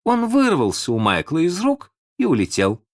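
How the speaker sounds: ordinary delivery, nothing marked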